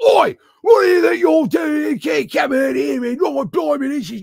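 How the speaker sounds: high, shouted mock Australian rant; bad Australian accent. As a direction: holler like a badly faked angry Aussie drunk. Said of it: "I can't do the accent".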